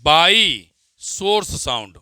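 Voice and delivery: slow, deliberate dictation pace. Acted. Teacher dictating a definition to students, slow dictation. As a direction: spell it out word by word for note-taking.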